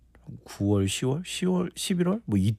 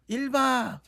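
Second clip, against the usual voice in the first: high, drawn-out call to a crowd; recreation MC call; 11 semitones higher, slower than usual. Retold or imitated. Guest showing team-building chants, imitated MC call. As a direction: lead the audience chant, slowly. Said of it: "레크레이션 하는 분들이 단합 이거 할 때".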